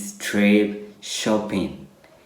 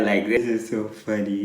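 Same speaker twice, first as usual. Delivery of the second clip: usual speaking voice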